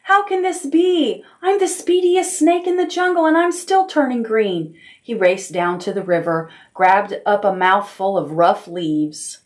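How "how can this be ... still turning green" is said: high, alarmed disbelief; dismayed. Acted. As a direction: cry out in high panic. Snake shocked at turning green.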